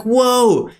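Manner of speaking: high, drawn exclamation of awe; mock amazement. Shopper seeing an inflated price, mock amazement.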